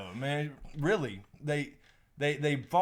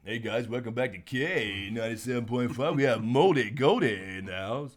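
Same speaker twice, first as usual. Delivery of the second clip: hammed-up radio DJ welcome, swelling louder at the end; put-on radio host patter; old radio voice; 5 dB louder than usual. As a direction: play an old-time station announcer on air. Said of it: "that was the old radio voice".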